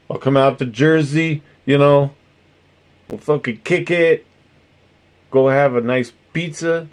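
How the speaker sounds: put-on East Coast accent, pitched slightly up; drifting mock accent. Streamer planning a pizza trip, drifting mock East Coast accent. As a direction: slip into a muddled Jersey pizzeria accent. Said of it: "see how it starts to evolve".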